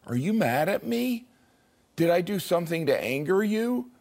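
lightly voiced anxious heart asking if he's upset; worried heart. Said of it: "I've actually heard my heart say".

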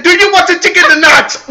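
mock impatient cashier voice; annoyed cashier. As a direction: snap it like an impatient worker.

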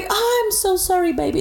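high, slow, sugary mock English; fake sweet apology. Stripper feigning sorry to the client, high sugary coo.

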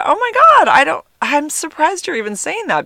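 high, loud oh my God; shocked exclamation. Retold or imitated. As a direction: burst out in stunned disbelief. Retold shocked reply.

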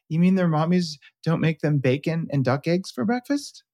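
put-on a kid baffled at the idea; dad retelling his son's question in a childish voice; imitating toddler son. Dad voicing toddler son again.

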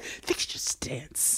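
hushed whisper, slow; whispered aside. Retold or imitated. Cohost whispering along about Jennifer's stance, hushed. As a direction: murmur it under your breath.

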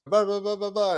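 high, mocking blah blah; mock reporter babble. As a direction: mimic reporters chattering nonsense.

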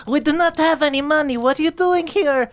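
put-on high, scared girl's voice; a wary orphan girl challenging strangers; teenage dwarf girl. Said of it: "the one teenage girl looks in and goes".

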